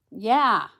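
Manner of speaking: ordinary delivery, nothing marked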